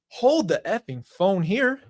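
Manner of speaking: retold incredulous outburst, slow and emphatic; indignant retort. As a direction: stop them short, stretching each word. Retelling his indignant retort to mom.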